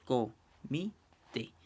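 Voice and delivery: comité very slowly as co, mi, té; split into syllables. Shown as a demonstration. Teacher dividing a word in three, comité syllable by syllable. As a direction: say comité in three separate syllables with long gaps. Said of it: "se separa en tres sílabas".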